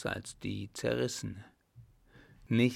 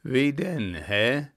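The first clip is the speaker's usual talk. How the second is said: loud, raised challenge; drunken agitation; 3 semitones higher, 6 dB louder, slower than usual. A narrator's reading. Drunk clown dares her to explain, agitated. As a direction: demand it, worked up with drink. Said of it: "zitternd vor Schnaps und Aufregung".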